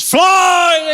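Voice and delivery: high, loud drawn-out yell; full shout. Acted. Two brothers launching the punt, shouting at full voice. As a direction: bellow the magic word up high. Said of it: "shouted at the top of their voices".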